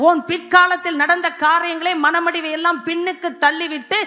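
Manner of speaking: high, driving sermon voice; exhorting the congregation at a high pitch; forceful preaching. Real, not acted. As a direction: preach it urgently, phrase on phrase.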